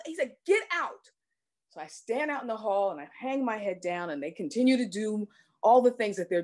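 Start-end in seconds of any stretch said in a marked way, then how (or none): 0.4-1.1 angry order. Retold or imitated. Doctor ordering the chaplain out of the room, angry shout. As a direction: throw her out of the room.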